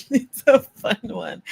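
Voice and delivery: bubbly laughing take. Acted. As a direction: giggly repeat of the line. Second take, laughing, higher.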